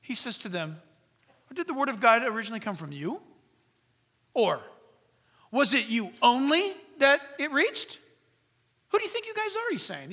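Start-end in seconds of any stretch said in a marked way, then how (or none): 1.5-8.0 pointed rhetorical questions, louder on the second; sarcastic rebuke. Acted. Paul rebukes the Corinthians, pointed and sarcastic. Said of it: "He's rebuking them". 8.9-9.7 very high-pitched, indignant question; incredulous rebuke. Acted. Paul rebukes the Corinthian church, incredulous. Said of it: "He's rebuking them".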